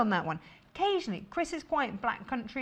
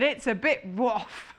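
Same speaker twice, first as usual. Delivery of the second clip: slow pun delivery through laughter; laughing; slower than usual. Real, not acted. Host reading out a viewer's dog pun, laughing. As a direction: laugh as you land the joke.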